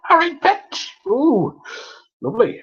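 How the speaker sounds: high-pitched, exaggerated friendly call; playful mock greeting. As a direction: call out a silly, over-friendly hello. Imagines greeting people in London.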